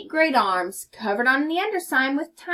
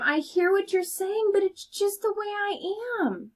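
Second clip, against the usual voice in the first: mopey fish voice, rising high; sulky fish answering his friend; a teacher voicing a sad fish; glum fish voice; 9 semitones higher than usual.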